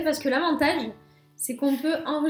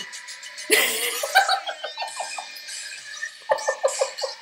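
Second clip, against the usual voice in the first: stifled giggles; 11 semitones higher, 5 dB quieter than usual. Real, not acted. Crazy time, laughing.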